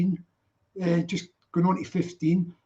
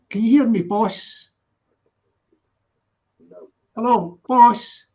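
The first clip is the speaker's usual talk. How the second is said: calling out; 6 dB louder than usual. Real, not acted. Calling to boss on muted phone.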